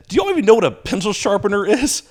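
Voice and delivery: exasperated question. Retold or imitated. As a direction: scold them in disbelief. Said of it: "I got so mad".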